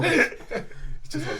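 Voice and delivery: high, breathless laughing; words lost in a high giggle. Real, not acted. Laughing at the long fall.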